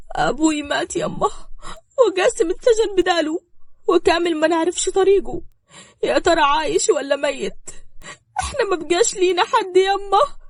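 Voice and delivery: high, loud tearful wail; bitter crying. Acted. Daughter telling her mother of father's death, crying bitterly. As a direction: wail it through heavy sobs. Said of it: "وهي بتعيط بحرقة".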